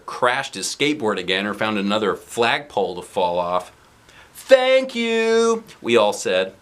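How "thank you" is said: put-on chorus of kids. Class answers in chorus.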